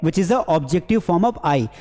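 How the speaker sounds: ordinary delivery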